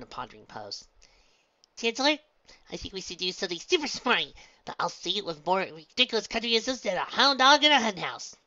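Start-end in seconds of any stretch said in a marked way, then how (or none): 1.8-8.4 high, loud put-on pronouncement; clever stage character. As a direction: show off with mock-smart bravado. Pinkie mimics a stage role.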